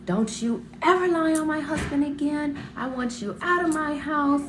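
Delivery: high, sharp, furious order; imitated angry aunt. Aunt slapping and shaking the girl, high angry scolding. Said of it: "And she's like".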